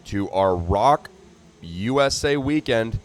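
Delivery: booming trackside announcer hyping the main event; hyped race announcer. Acted. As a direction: call it out to the whole crowd.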